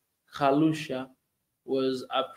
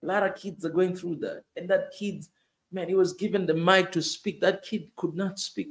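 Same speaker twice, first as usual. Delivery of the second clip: usual speaking voice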